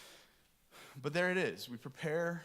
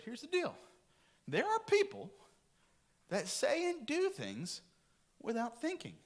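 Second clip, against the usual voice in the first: high mock-wise voice; 12 semitones higher than usual. Retelling advice given to kids.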